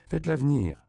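high, loud order; commanding. A narrator's reading. Myriam orders Josefa to fetch the child, commanding. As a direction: give a firm, imperious command. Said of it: "intima-t-elle à Josefa".

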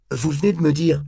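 high, loud exclamation; indignant cry. A narrator's reading. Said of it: "s'écria Temple".

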